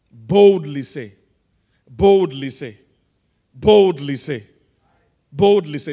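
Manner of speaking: loud, emphatic preacher's refrain; bold declaration. Shown as a demonstration. Preacher shows congregation how to say it, bold declaration. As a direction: hammer BOLDLY out loud, stretched and forceful. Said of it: "How should you say it?".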